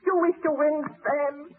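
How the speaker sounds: usual speaking voice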